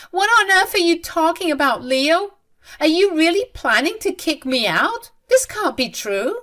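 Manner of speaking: high, loud incredulous questions; can't believe her own son; shocked disbelief. A narrator's reading. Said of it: "Lena was still in complete disbelief".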